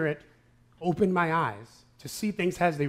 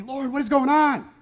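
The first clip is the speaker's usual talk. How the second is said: high, louder cry of frustration to the Lord; exasperated plea; 7 semitones higher, 5 dB louder than usual. Retold or imitated. Pastor voicing his frustrated prayer, exasperated plea. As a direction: throw up your hands and ask why. Voices himself crying out to God.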